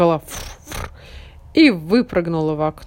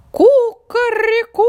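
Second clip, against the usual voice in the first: high, slow crowing kukareku; rooster crow; 8 dB louder, slower than usual. A narrator's reading. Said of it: "закричал".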